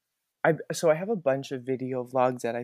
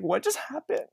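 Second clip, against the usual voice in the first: much higher, dazed question; stunned; 12 semitones higher than usual. Retold or imitated. After the emotional set, stunned and higher. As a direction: ask it in disbelief. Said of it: "I was like".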